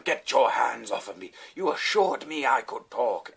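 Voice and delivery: loud, high, theatrical British protest; indignant British man. Retold or imitated. Arrested diner in a headlock protesting to police, mock indignant British yell. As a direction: protest grandly in a put-on British voice. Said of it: "like, British or something".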